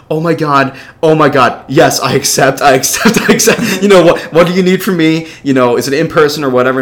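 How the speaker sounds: loud, high, tumbling excitement; overjoyed. Retold or imitated. Intern-to-be accepts the recruiter's offer, loudly overjoyed. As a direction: gush it at the top of your range, repeating yourself.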